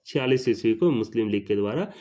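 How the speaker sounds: normal talking voice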